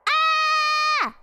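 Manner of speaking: high, sustained "Ah" yell; loud shout. Shown as a demonstration. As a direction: belt out one long, high cry to prove you're loud. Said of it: "That was loud".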